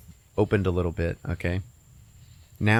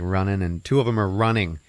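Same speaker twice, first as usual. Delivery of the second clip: ordinary talk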